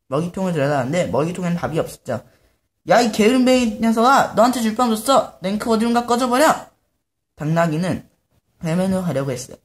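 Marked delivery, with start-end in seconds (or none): 2.9-6.7 higher, louder scolding; angry owner yelling get lost; angry master. Acted. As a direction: chase the donkey away in anger. Owner kicks donkey out.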